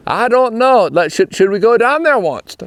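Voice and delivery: lightly voicing a Pennsylvania Dutch farmer; Pennsylvania Dutch folk quote. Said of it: "they'll just say".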